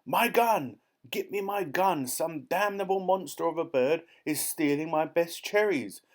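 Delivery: loud, high-pitched outraged bellowing; blustering, high, angry shouts; furious shouting. A narrator's reading. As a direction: rage at the thief at full voice. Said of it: "the Duke was shouting".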